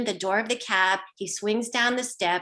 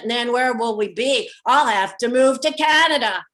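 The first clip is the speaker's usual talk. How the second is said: loud, slow proclamation; yelling; 6 dB louder than usual. Retold or imitated. Driver yelling politics as the truck speeds up, loud. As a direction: bellow your opinions over the freeway wind. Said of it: "he is yelling now as we pick up speed".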